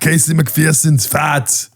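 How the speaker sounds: put-on Schwarzenegger accent. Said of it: "do you wanna do the camera".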